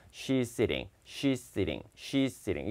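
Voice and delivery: "she's sitting" repeated softly as one linked word; joined as one sound. Shown as a demonstration. Teacher drills students to make it a habit, joined as one sound. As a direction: run "she's sitting" together, t lightly tapped. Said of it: "한 소리처럼 붙여서".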